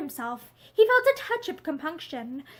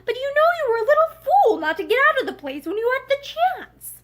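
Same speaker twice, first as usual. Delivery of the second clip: higher, louder exasperated scold; 9 semitones higher, 5 dB louder than usual. A narrator's reading. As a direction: tell her off, half frustrated. Scolding the crying girl.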